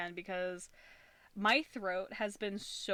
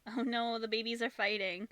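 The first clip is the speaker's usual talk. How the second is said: ordinary delivery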